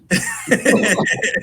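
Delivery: high, loud delighted laugh; burst of laughter. Real, not acted. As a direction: laugh out loud at the happy ending. Happy ending, bursts out laughing.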